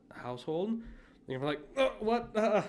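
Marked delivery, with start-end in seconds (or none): 1.8-2.6 high, grunting, baffled mock baby; high-pitched befuddled grunts and words; confused grunting baby. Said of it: "grunts and stuff like that".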